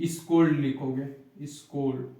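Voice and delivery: ordinary delivery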